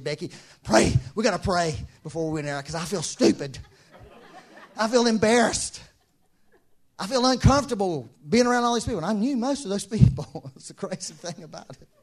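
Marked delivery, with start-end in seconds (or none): 0.0-9.0 loud, laughing mock panic; laughing nervous plea. Speaker telling wife Becky he feels stupid, laughing. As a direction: beg half-joking.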